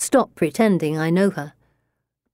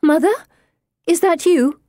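high, louder, hopeful call; 10 semitones higher, 5 dB louder than usual. A narrator's reading. Girl calls to her dead mother at a séance, high hopeful cry. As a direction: call out to a lost mother, pitch lifted. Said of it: "I can't help calling out to her".

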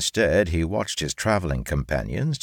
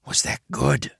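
hushed, higher-pitched stage whisper; whispered aside; 9 semitones higher than usual. A narrator's reading. Mike checks with Red Baron, whispering. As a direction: drop out of the performance to ask privately. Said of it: "Then he whispered to Red Baron".

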